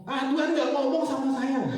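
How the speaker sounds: high-pitched comic protest with laughter; scoffing objection. Retold or imitated. Husband to wife about God speaking, comic scoffing. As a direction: brush her off in mock indignation.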